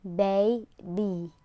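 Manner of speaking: put-on baby ending in a smiling long E; model word baby. Shown as a demonstration. Teacher modelling baby with the long E. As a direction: smile on the end of baby. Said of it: "So it has to smile".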